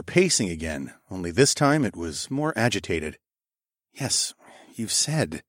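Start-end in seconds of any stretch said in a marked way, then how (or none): 3.9-5.4 soft, calm murmur; hushed and soothing. A narrator's reading. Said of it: "I murmured calmly".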